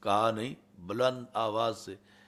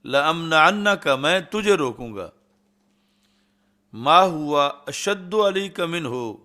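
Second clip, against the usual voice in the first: higher, louder defiant threat; angry raised voice; 4 semitones higher, 5 dB louder than usual. Retold or imitated. Saad answering Abu Jahl's threat, angry raised voice. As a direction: fire the warning back at him. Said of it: "ورفع صوتہ علیہ".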